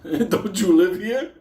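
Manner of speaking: slow squeaky laughing question; laughing disbelief. Retold or imitated. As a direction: ask it baffled while laughing.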